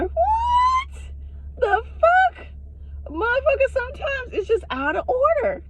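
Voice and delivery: very high, squeaky disbelief; high-pitched indignant reaction; incredulous outrage. Acted. Outraged reaction to boyfriend's words.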